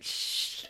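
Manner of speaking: long hushing "shh"; drunken shushing. Retold or imitated. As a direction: shush like a tipsy giggler. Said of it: "giggling. It's like".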